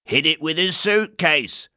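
high, put-on voice, a mild shout; another bystander. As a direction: call it out from the crowd. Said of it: "shouted another voice".

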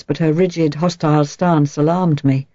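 plain reading voice

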